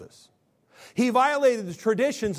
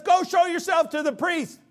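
raised, high call to go; Jesus calling out; 9 semitones higher than usual. Retold or imitated. Jesus sending the lepers off, raised call. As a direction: call it out across the road. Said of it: "And Jesus says".

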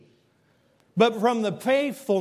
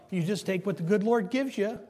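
ordinary delivery

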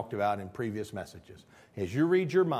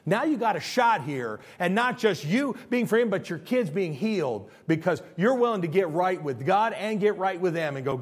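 normal talking voice

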